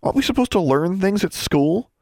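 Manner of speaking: high, incredulous scoff. A narrator's reading. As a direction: scoff with a high rising question. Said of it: "Alexandra scoffed".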